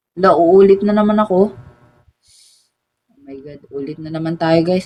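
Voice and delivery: ordinary delivery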